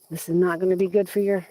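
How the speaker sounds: usual speaking voice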